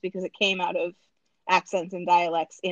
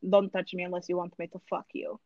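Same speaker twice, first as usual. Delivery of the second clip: low, soft Russian-accented threat; 3 semitones lower, 5 dB quieter, faster than usual. Shown as a demonstration. Showing her accent trigger phrase, Russian accent. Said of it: "And Russian, for me, the trigger".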